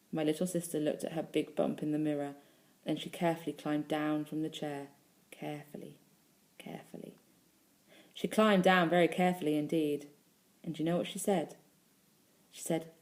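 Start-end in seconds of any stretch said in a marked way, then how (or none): 5.3-7.1 very soft, fading to a whisper; careful hush. A narrator's reading. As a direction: hush each word as if tiptoeing down. Child climbs down with great care.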